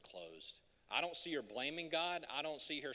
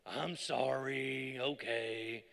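slow, sulky drawn-out sorry; grudging sibling apology; 3 semitones lower, much slower than usual. Retold or imitated. Kid apologizing to sibling because parents demand it, sulky. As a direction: drag out a forced kid's apology.

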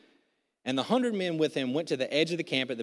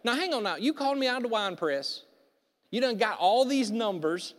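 louder, higher protest at God; mock protest; 8 semitones higher, 5 dB louder than usual. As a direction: push back like an indignant Gideon. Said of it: "I'm like".

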